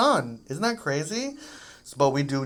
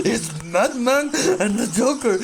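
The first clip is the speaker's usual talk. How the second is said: hammed-up excited voice; 8 semitones higher, 7 dB louder than usual. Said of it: "And be like".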